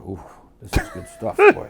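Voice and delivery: put-on high, airy old man's delight; old mob boss impression. Impersonating the old boss relishing the plan. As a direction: savour it like the old boss tasting a deal. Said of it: "He go".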